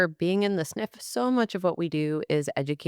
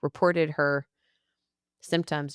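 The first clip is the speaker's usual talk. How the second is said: usual speaking voice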